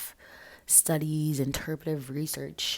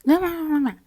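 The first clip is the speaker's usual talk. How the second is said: high shout; 13 semitones higher, 10 dB louder, much faster than usual. Retold or imitated. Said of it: "I'm like".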